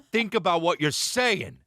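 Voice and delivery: acted-out telling-off; confrontational retort. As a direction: draw out each word to scold him. Retelling confrontation with rude fan.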